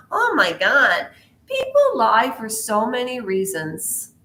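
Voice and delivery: ordinary delivery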